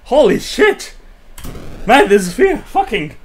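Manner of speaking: high, loud frantic swearing; excited exclaiming. Real, not acted. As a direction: yelp it out, overwhelmed.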